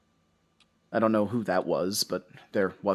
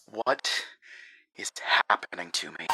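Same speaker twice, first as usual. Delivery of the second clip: whispered, shaken question; dazed whisper. Acted. Husband whispering in shock back at the lake house. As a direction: whisper it to yourself, stunned.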